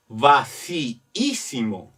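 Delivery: "vacíísimo" in parts va-cí-ísimo, both i's sounded; drawn-out double i. Shown as a demonstration. Teacher modelling intonation so ísimo is noticed, drawn-out double i. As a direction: lengthen "vacíísimo" so the ísimo stands out. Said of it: "es la entonación".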